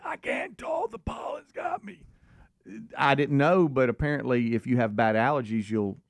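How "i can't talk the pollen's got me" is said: high strained near-whisper; lost voice. Retold or imitated. Dad telling the speaker he can't talk, in a strained whisper. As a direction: barely get the words out, airy and pinched. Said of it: "what'd you say".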